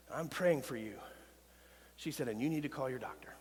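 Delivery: hushed, confiding retelling of the darkest night; hushed confession. Real, not acted. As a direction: let the voice drop low, confiding. Quiet retelling of a suicide crisis.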